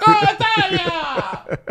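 loud, high, stretched Italia; booming sing song welcome. Retold or imitated. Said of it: "He's like".

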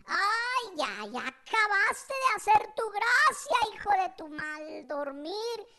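drawn-out, hammed-up scolding; mock exasperated mother. Mother over the cereal mishap, mock exasperation. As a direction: imitate Mom wailing her fed-up complaint slowly. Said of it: "toda su colección esa de maldiciones".

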